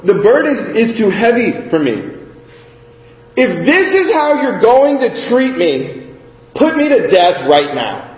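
reading scripture aloud with rising feeling. Acted. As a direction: read the passage like a sermon lesson, lifting it.

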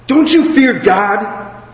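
lightly voiced rebuking criminal; a hint of stern reproof, drawn out; mild rebuke. A narrator's reading. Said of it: "rebuked him".

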